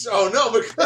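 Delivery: high, loud squeal of delight; excited shriek. Real, not acted. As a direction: scream at the plot twist. Shocked fan reaction to twist.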